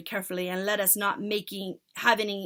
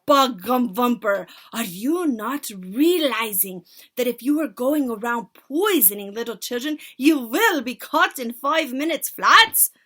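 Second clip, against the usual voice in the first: screeching insult; 4 semitones higher, 11 dB louder than usual. Acted. Witch leader screeching at the culprit, loud. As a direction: spit the insult. Furious tirade.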